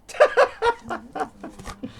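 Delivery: long, high rising laugh; high drawn-out chuckle; amused laugh. Real, not acted. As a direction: laugh at your own joke. Laughs at the empty DVD case.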